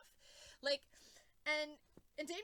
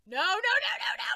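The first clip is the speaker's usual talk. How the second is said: loud, very high string of no's; hammed-up panic, climbing to a squeal; panicked; 9 semitones higher, 15 dB louder, faster than usual. Panicked reaction to countdown.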